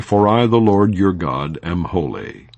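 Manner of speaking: ordinary talk, nothing marked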